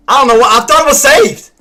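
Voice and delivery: can't believe it wasn't saved; frustrated disbelief. Real, not acted. Trader to his stream, exasperated and loud.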